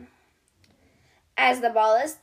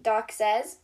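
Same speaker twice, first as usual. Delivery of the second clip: usual speaking voice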